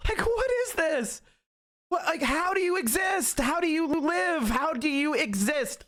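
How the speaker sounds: high, exasperated questioning; baffled outburst. Real, not acted. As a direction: demand an answer in total disbelief. Baffled rant at opponents.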